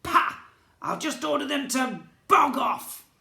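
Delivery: high, loud, scornful goblin snort. A narrator's reading. Goblin prince dismissing the humans, high loud scorn. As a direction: scoff and brush them off.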